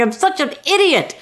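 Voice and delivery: acted embarrassed self-scolding; mock self-reproach. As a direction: berate yourself in a retold fluster. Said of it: "make a fool of myself".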